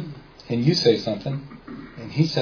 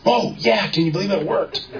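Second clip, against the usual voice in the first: high, laughing delight; gleeful laugh at the win; laughing disbelief; 9 semitones higher than usual. Retold or imitated. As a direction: squeal it out through a laugh. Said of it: "And Hal goes".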